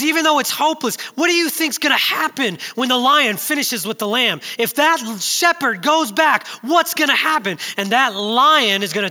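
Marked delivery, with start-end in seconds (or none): 0.0-7.6 loud, high rhetorical question; preacher's loud challenge. Retold or imitated. Quoting Bill Wilson's sermon to the congregation, loud and high. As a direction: hammer the question home to the crowd. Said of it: "he says".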